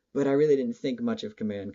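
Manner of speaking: usual speaking voice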